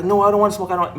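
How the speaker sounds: quoting a panicked refusal, lightly hammed up; imitated protest. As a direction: act out a reluctant kid pushing back. Son refusing forced smoking.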